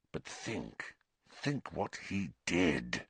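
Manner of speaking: very soft, low appeal; hushed urging. A narrator's reading. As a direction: press gently, slowing at the end.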